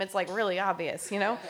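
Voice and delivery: normal talking voice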